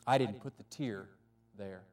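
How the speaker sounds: quiet, somber and low. Acted. The Lord quietly tells the angel about the tear, somber and low. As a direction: let the sadness weigh each word. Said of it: "The Lord looked somber".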